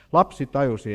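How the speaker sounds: normal talking voice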